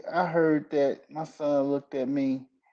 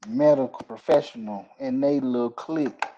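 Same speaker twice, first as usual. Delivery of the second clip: usual speaking voice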